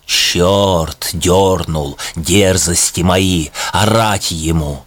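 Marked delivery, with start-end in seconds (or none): none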